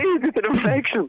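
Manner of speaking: high, slow mock solemn answer; mock serious voice. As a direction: put on a pompous high voice. Mock answer to the message question.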